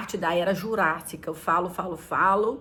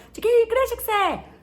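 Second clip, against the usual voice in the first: higher curious asker's voice; questioner; 12 semitones higher than usual. Retold or imitated. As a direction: ask in a puzzled, higher voice. Imitating people asking.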